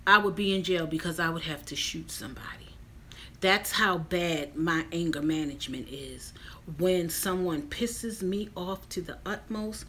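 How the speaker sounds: unvoiced whispered confession; hushed confiding whisper. Real, not acted. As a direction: drop to a secret whisper. Confessing rage in hushed aside.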